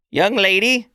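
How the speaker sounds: high, stern address; old orc elder. Acted. Elder addresses Mama Sass, high and stern. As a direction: summon her attention firmly. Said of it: "the old woman looks at Mama Sass and says".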